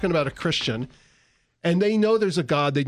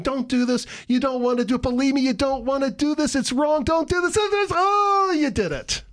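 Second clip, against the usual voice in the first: urging higher and higher, then deflating on "you did it"; firm, urgent God; 10 semitones higher than usual. Acted. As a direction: plead more and more insistently to stop them. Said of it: "And God's going".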